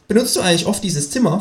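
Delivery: hoch gesetzte, freche Känguru-Stimme. Acted. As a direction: das Känguru schnoddrig und höher sprechen. Känguru fragt. (hohe, freche Känguru-Stimme)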